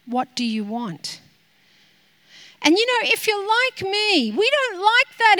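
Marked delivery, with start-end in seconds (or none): 0.0-1.2 low, hushed voice of God; God asks her gently, in a low hushed voice; God speaking softly. Retold or imitated. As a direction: let it fall quiet and gentle, like God asking. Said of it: "I felt he has turned to me and said".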